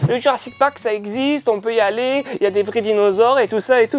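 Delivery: put-on kid classmate voice. Classmates quoted in playground.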